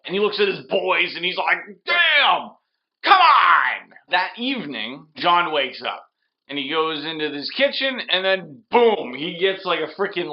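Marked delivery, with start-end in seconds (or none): 1.9-3.9 high, loud mock outburst; a thug's sore-loser cry; mock frustrated yell. Theon's boys hearing the car's not for sale, loud mock yell. Thug reacts to refusal.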